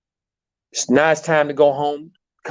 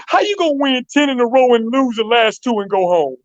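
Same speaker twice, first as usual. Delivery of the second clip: put-on a teen star fuming over a lost game, pitched-up protest; frustrated young Kobe; 7 semitones higher than usual. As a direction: voice a furious teenage Kobe, pitched up. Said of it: "He said".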